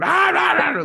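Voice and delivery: high, loud ranting noise; mock rage shouting. Mimicking a Karen's meltdown, high and loud. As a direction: rant like a furious customer.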